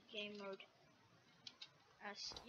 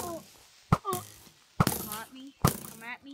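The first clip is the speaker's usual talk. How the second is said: loud taunt; 2 semitones higher, 11 dB louder than usual. Pretend. Taunting the mob.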